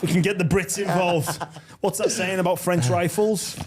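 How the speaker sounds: talking through laughter, high; laughing while teasing mate. Real, not acted. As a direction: chuckle through the words. Laughing after joke.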